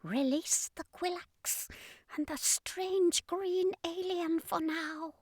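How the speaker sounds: high, slow, breathy alien order; alien commander ordering. Acted. Alien commander ordering his soldiers, high slow alien voice. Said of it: "the commander ordered".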